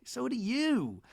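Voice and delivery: high, drawn-out grande dame thanks; Miss Patricia. Retold or imitated. Matriarch answering a compliment, high put-on gushing. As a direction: lilt it high and stretch the endings. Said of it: "Missus Patricia's like".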